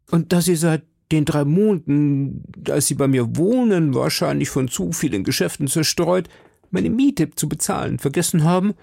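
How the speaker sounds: worried citizen. Acted. Citizen confiding in D'Artagnan about the plot, lightly voiced worried citizen.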